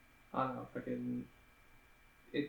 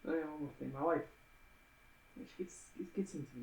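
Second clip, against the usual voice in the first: Columbo impression; 11 dB quieter, faster than usual. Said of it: "Columbo all the way".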